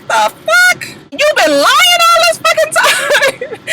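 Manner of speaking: very high, slow, stunned mock voice; high hammed-up shock; shocked castmate. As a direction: squeal it out in disbelief. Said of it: "listening like".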